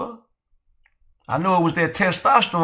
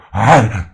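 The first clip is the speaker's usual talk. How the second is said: loud laugh; 6 semitones lower, 9 dB louder than usual. Real, not acted. Laughing at own joke.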